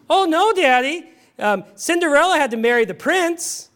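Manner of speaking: a hint of an insistent little daughter; lightly voiced little girl. Little Abby correcting Daddy, lightly voiced and eager.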